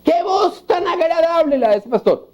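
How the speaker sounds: mock a fan swooning over the preacher; a hint of a starry-eyed congregant; gushing churchgoer.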